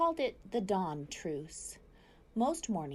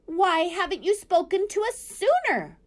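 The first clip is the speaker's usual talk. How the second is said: high, loud demanding screech; owl screech; 12 semitones higher, 9 dB louder than usual. A narrator's reading. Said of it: "screeched the owl".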